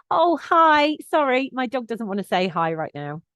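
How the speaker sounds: cheerful but no-nonsense, heading off an approaching owner; polite, firm heads-up to a dog walker; friendly but firm. Shown as a demonstration. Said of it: "it's friendly, it's firm ... it's informative".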